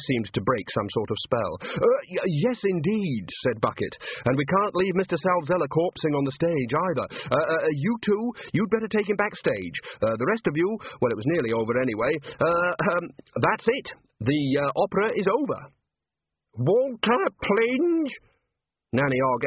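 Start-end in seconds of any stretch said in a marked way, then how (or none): 1.6-3.2 high, stammering agreement; hesitant, flustered manager. Acted. Bucket flustered as the spell breaks. 4.2-15.6 high-pitched, stammering orders; hesitant, rising to an announcement; flustered manager. Acted. Flustered Bucket gives orders on stage. 16.6-18.1 high, drawn-out call; piercing, high-pitched old mother voice; scolding mother. Acted. Walter's mother calls him to account.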